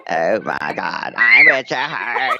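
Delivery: Witcher Heart voice. Retold or imitated. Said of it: "Is that your Witcher Heart voice?".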